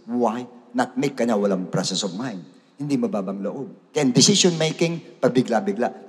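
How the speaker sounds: ordinary talk, nothing marked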